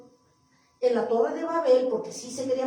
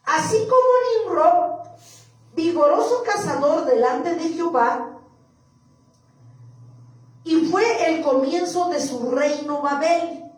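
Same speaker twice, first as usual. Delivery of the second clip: scripture reading; 3 semitones higher, 4 dB louder than usual. Acted. Preacher reading of Nimrod to the church, emphatic scripture reading. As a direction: declaim the verse firmly.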